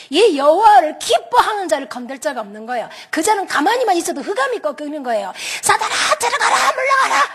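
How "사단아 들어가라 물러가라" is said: very high, strained imitation of rebuking; mock exorcism command. Believer ordering Satan away, high mock exorcism cry. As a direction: imitate someone zealously driving out Satan.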